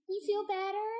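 high squeaky pet voice; cat voice. Pretend. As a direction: talk as the cat. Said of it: "I love your cat voice".

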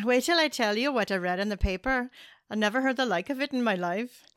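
lilting attempt at an Irish accent. Shown as a demonstration. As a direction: read it like an Irish storyteller. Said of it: "say this in an Irish accent".

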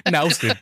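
"Nausen" said as NOW-sen; mispronounced name. Shown as a demonstration. Showing friends how people say the name, mispronounced. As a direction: start "Nausen" like the word now. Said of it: "that's how people pronounce it".

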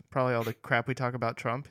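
ordinary talk, nothing marked

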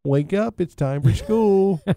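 high, drawn-out mock mother's wake-up call; mother waking child. Podcast host nags listeners to subscribe, mock mother waking a child. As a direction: sing it out like a mum rousing a schoolkid. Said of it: "I'm not your mother".

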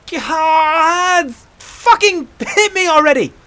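high, loud, drawn-out yell; exasperated shout. Acted. Aela demanding the hunter hit her, shouting. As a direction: scream it out in frustration. Acted quarrel, demanding a punch.

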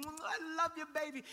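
put-on high baby-loving gush; imitating a parent smothering a baby with kisses; doting kiss. Dad kissing his nine-month-old son, doting. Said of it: "the same way I kiss my nine-month-old son".

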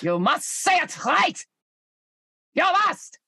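put-on stern German-accented command, pitched high; mock German accent. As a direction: order it like a strict German instructor. Bossy German put-on, ordering pronunciation.

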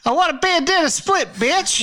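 put-on high bratty kid demand. Kid demanding a bandana split from mom, high and bratty. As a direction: whine it way up high.

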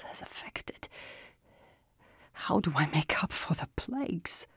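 tired whispering voice of a sick man; a dying man too worn to raise his voice; weary sick man. A narrator's reading. Arius, sick, speaks weakly.

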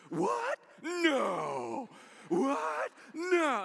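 high, frantic cartoon shouts; Forky's panic. Pastor voicing Forky's panic to the church, high frantic shouting. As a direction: yelp it like a panicked toy. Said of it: "this is all I heard".

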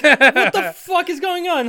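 high, slow, hammed-up outraged yell; imitating Polnareff losing it; mock freak-out. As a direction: blow up in comic outrage. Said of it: "he goes fucking apeshit".

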